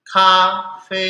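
Kafe chanted high and level; "Kafe" with both syllables in a sustained high tone; first tone. Shown as a demonstration. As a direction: hold both syllables of "Kafe" long on one high pitch. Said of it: "Semua nada satu".

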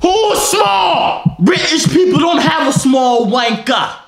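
high, loud indignant British shout; hammed-up British indignation; mock outrage. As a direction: shout back as an insulted Brit, slowing down. Acted outrage at insult to British people.

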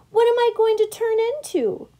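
high, loud thrilled question; eager excitement. A narrator's reading. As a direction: burst out with eager curiosity. Pollywog learns it will change.

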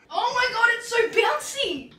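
high, loud burst of glee; thrilled and squealing with delight. Real, not acted. Delighted trying the new mattress.

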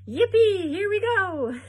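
whooping, high and very loud; gleeful shout. A narrator's reading. Grandpa Joe whooping as the elevator drops, gleeful high shout. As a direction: shout it out like a thrilled old rider. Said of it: "Grandpa Joe shouted".

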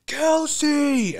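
a high, long shout of the name; calling out. Retold or imitated. Fan in the stands yelling to a coach, calling out. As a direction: yell to get his attention.

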